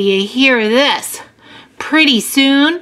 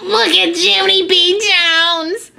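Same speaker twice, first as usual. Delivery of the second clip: high schoolyard jeer; mocking kids pointing someone out; hollered taunt; 8 semitones higher than usual. A narrator's reading. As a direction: call out tauntingly, high-pitched. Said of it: "they hollered".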